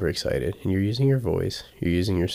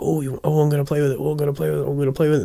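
high, loud gushing at the toy; excited toy talk; 8 semitones higher, 5 dB louder, much faster than usual. Shown as a demonstration. Owner talking to the toy to excite the dog. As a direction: gush at the toy to tempt the dog. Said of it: "I'll be like".

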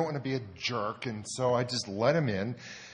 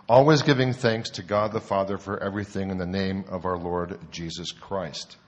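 scripture reading; 4 semitones lower than usual. Acted.

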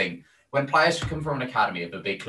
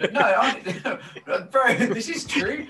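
very high, laughing protest; 14 semitones higher, 4 dB louder, much slower than usual. Real, not acted. Teased co-host defending his wage point, laughing. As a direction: protest through giggles, pitched way up and drawn out.

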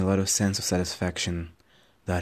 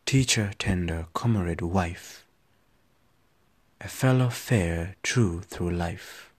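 measured poetry performance; poem recitation; slower than usual. Acted. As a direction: recite it with weight. Rereads poem.

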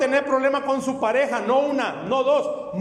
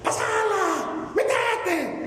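high mock screaming fan; shrill-pitched imitation of a shouting wife at a match; mock shouting soccer fan; imitating wife's yells; 10 semitones higher, much slower than usual. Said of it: "mi esposa grita".

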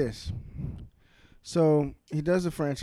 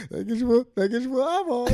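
high-pitched, helpless laughing repeat; 8 semitones higher, 4 dB louder than usual. Real, not acted. Co-host repeating the funny line to friends, cracking up. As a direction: barely get it out through laughter.

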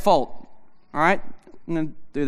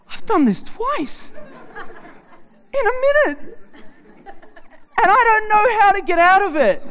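high, laughing, half-crying wail at himself; mock despair through laughter; laughing panic; slower than usual. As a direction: laugh out the helpless confession. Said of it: "I'm like".

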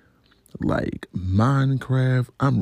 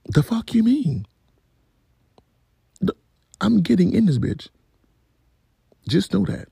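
high-pitched, affronted retort; indignant, much higher voice; indignant comeback; 11 semitones higher than usual. Retold or imitated. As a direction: throw it back at him, high and offended. Acted indignant comeback to passerby.

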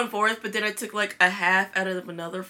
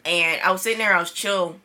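normal talking voice

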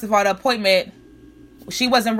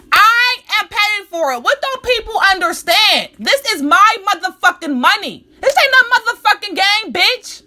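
heated rant; 7 semitones higher than usual. Real, not acted. Ranting about her money.

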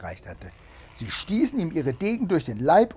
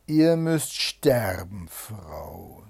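slow, drawn-out death sentence, loud then falling away; menacing Bluebeard; 4 semitones lower, 6 dB louder, much slower than usual. A narrator's reading. Bluebeard tells his wife she must die, menacing. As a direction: pronounce doom slowly, dropping off at the end.

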